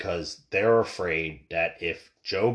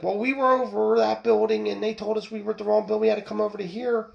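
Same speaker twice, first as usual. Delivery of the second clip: higher, fast whiny excuse; mock latecomers' excuse; 11 semitones higher, faster than usual. Line-cutters explaining to the poll worker, high and hurried mock. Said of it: "And they're like".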